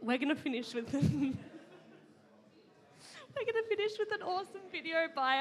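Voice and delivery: flustered host laughing into the intro. Real, not acted. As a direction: push through the giggles. Laughs while introducing video.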